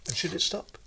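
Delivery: breathy whispered question. Real, not acted. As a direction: whisper it as an aside. Whispered aside in interview.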